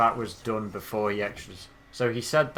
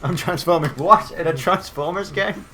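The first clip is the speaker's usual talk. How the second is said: excited mock shout; 8 semitones higher, 10 dB louder than usual. Mimicking game character line.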